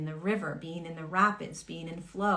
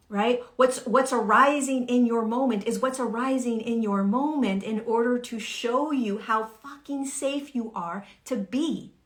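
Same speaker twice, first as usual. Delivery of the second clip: normal talking voice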